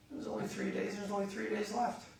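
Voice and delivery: high-pitched fretting; acted holiday panic, pitched high; mock dismay. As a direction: whine in disbelief that time is short. Vacationer starting to fret over time.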